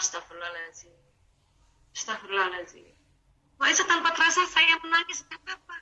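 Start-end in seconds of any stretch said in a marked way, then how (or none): 0.0-2.9 low, much softer murmured prayer; murmured istighfar. Shown as a demonstration. Teacher showing listeners how to murmur istighfar softly. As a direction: breathe it out softly under the breath.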